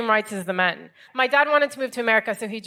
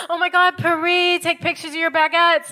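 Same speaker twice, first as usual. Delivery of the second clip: gushing friends; 7 semitones higher than usual. Retold or imitated. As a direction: squeal like thrilled American friends.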